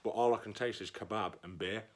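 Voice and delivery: toothpaste in mouth; while brushing teeth. Real, not acted. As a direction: talk round a mouthful of toothpaste. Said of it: "I've got toothpaste in my mouth".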